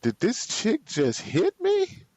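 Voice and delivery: high, slow disbelieving cry; incredulous outrage. Retold or imitated. Retelling the hit to a friend, high outraged disbelief. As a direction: replay the shock of being hit. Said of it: "I am so heated".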